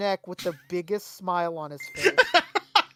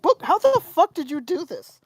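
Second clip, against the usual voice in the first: disbelief; 8 semitones higher, 4 dB louder than usual. Retold or imitated. Incredulous quote to Boog.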